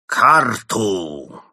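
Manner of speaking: loud, hoarse villainous demand; rasping villain's command, loud and drawn-out. A narrator's reading. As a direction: croak it out like a sinister henchman. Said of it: "раздался хриплый, злодейский голос посланника".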